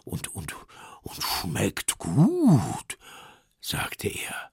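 slow bear voice, humming over the taste; drawn-out, relishing bear; savouring bear. Acted. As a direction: savour each word like a tasty mouthful. Bear tastes melted chocolate in kettle.

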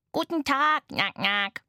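high greeting, then quacks; quacking toy duck. A narrator's reading. Plastikente begrüßt mit Quaken. (quakende Spielzeugente)

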